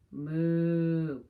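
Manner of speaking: a loud, drawn-out mock moo; playful cow lowing. Cows typing refrain.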